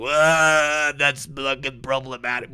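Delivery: prissy mock scold, drawn out slow; mock the offended leftist, slow and sniffy; sneering imitation of an offended critic. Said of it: "Everybody's like".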